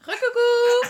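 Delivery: playful coucou. Real, not acted. Mom greets her baby on camera in a high, loud playful voice.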